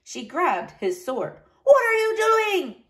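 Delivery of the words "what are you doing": very high alarmed cry. A narrator's reading. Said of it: "he cried".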